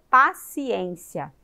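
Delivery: "Paciência" slowly split into pa-ci-ên-cia; syllable by syllable. Shown as a demonstration. Teacher confirming four syllables to children, slow and split. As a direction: recap "Paciência" in measured, separate syllables. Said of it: "a boca quatro vezes".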